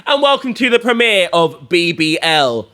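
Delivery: loud, high, projected presenter voice; hyped talk-show opening; show host intro. Acted. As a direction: announce it big, building to the guest's name. Opens show, introduces guest.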